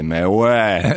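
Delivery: Swiss French "Mais ouais" with a rise at the end; rising "Mais ouais" the way they say it in Geneva; Swiss French accent. Retold or imitated. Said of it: "And they say".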